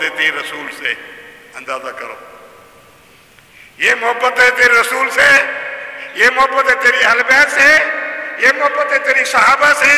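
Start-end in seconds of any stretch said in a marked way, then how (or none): 3.8-9.9 high, loud ringing proclamation; rising fervent sermon cry; proclaiming loudly. Shown as a demonstration. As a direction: proclaim each phrase louder and higher. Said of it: "repeats the line louder".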